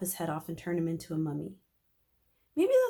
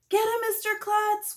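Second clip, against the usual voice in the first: put-on high, loud kids cheering; shouted; 6 dB louder than usual. A narrator's reading. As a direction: yell encouragement. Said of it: "we all shouted".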